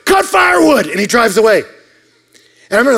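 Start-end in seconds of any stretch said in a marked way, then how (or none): 0.1-0.9 high, loud yell from a driving truck; retold yell. Old man yells at the kid through the truck window, loud high yell. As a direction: shout it out the window. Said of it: "I just hear him yell at the window".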